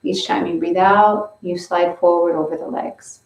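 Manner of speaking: usual speaking voice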